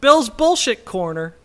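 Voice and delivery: slow, big segment-title announcement; announcer voice. Pretend.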